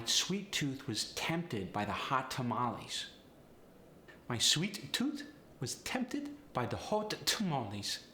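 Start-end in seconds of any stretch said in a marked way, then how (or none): 4.3-8.0 Polish accent with overemphasized T; higher-pitched Polish accent, hit every T hard. Shown as a demonstration. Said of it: "really overemphasize the T".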